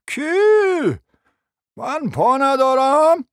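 high, drawn-out startled question; incredulous. Acted. Prince hearing he has no legs, astonished and affronted. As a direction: query it in high-pitched disbelief.

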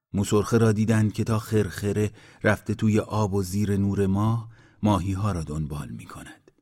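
ordinary delivery, nothing marked

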